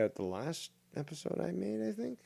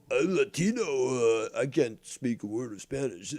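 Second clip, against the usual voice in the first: mocking impression. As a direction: mimic him as a blubbering crybaby. Mocks the caller with a quote.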